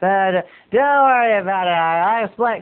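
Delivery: stammering light impression of a friend; Dan Raphael. Shown as a demonstration. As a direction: show how a buddy talks, stumbling slightly. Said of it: "That's kinda how Dan Raphael talks".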